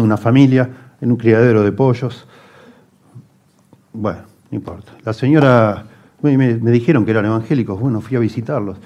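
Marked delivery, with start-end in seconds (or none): none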